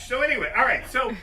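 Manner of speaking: ordinary delivery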